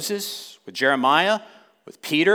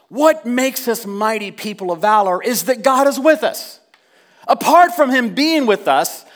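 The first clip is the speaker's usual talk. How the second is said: high, loud, surging sermon climax; rising fervent pulpit voice; passionate preaching; 7 semitones higher, 5 dB louder than usual. Acted. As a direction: preach it to the back row. Preaching at full force.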